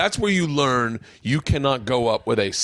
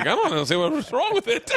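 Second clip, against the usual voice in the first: mock Cuban in-law; 8 semitones higher than usual. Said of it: "your in-laws are like".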